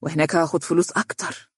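mildly mocking retort; sarcastic. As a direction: answer with understated sarcasm. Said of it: "قال حسن بتهكم". (بتهكم خفيف)

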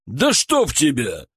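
протяжная досадливая ругань; медленно выругаться с досадой; в сердцах. Acted. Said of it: "в сердцах хлопнул себя". (в сердцах, с досадой)

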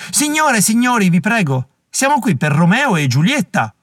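high pleading call over the noise. A narrator's reading. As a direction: beg the crowd to settle. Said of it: "alzò ancora la voce".

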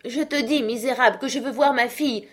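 put-on high, loud angry old father; father raging at the man behind the door; furious cry. A narrator's reading. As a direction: hurl it at him. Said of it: "s'écria monsieur Manxit".

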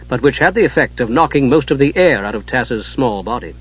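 ordinary delivery

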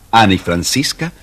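plain reading voice

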